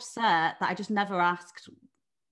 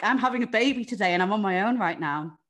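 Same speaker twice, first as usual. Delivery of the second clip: louder, exasperated cry; exasperated outburst; 3 semitones higher, 8 dB louder than usual. Retold or imitated. Alone at the hospital, telling herself, louder exasperation. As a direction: throw it out in frustrated disbelief. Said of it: "And I'm like".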